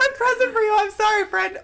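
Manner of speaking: high teasing parody; mocking high voice. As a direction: squeak it out sweetly. Teasing imitation of mom.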